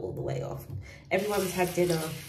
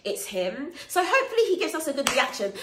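ordinary talk, nothing marked

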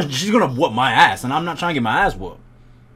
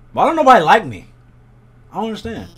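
loud, higher mock-hurt cry; mock wail; 5 semitones higher, 7 dB louder, faster than usual. Player rejected by classmates, loud mock-hurt wail. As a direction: wail it like a sulking kid.

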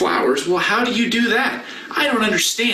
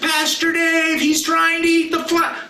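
shouted call; 11 semitones higher than usual. Shown as a demonstration. Pastor showing the kids how to shout Pastor Dave. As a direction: call out like a kid warning. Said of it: "you just shout".

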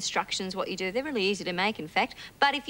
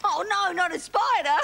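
a touch of mock fear, a bit higher; mock fright; 6 semitones higher, 3 dB louder than usual. Shown as a demonstration. Showing the puppet how to act scared of a spider. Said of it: "you're supposed to say".

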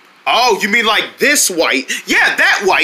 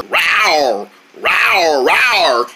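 playful falling rawr; pretend dinosaur roar; much slower than usual. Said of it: "even though dinosaurs roar".

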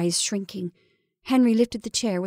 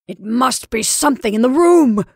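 loud, forceful snap; snapping like a lash; 4 semitones higher, 8 dB louder than usual. Acted. Henry declares it after moving furniture, snapping. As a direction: crack the words like a whip. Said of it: "in a voice which seemed to snap like a lash".

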